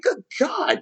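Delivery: high, mock-exasperated cry; exasperated exclamation. Reacting to an impatient fan, a high exasperated cry. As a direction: throw up your hands in disbelief. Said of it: "I was like".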